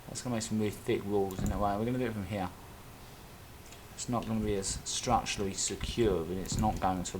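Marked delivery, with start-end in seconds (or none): none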